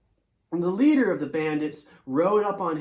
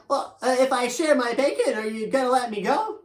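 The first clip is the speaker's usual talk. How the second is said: higher, louder, nervous bargaining; voiced horse Tempo; 6 semitones higher, 4 dB louder than usual. Tempo bargaining with the bandit for freedom, higher and fast. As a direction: hurry the question out as the horse.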